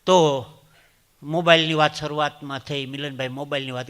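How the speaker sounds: normal talking voice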